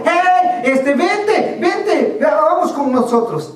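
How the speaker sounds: high, urgent calling out; appel pressant. Acted. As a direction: call him over to the boat. Boat driver calls to the man.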